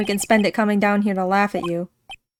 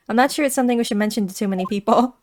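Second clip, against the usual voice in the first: usual speaking voice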